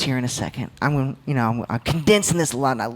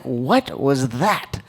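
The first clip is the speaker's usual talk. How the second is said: stunned disbelief; 4 semitones lower, much slower than usual. Retold or imitated. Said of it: "look at each other like".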